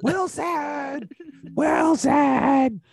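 desperate shout. Retold or imitated. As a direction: cry out after the drifting ball. Imitating Castaway scene cry.

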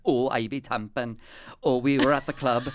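comic Welsh lilt; Welsh accent. Retold or imitated. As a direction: imitate a Welsh reality show party-goer. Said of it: "it takes place in Wales".

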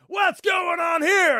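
loud high shout. Retold or imitated. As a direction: yell it in a brawl. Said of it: "someone goes".

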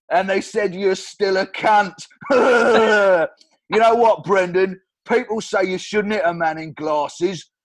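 put-on loud laughing drunken taunts; drunk Stu. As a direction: jeer like a nasty drunk lout. Drunk Stu insulting Brendan.

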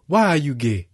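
replaying the clip; loud imitation; mock interviewer voice. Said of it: "They gonna pull up".

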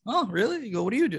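high-pitched mock surprise; Kevin Farley. Comic imitating Kevin Farley asking about his job, mock surprised. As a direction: pipe up with exaggerated interest.

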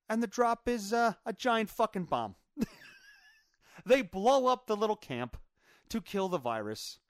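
ordinary delivery

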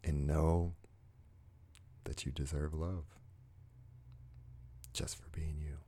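soft, unhurried guided meditation voice; guided heart moment. Acted. Host guiding viewers to love themselves, soft meditative voice. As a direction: gently talk the listener into a calm moment.

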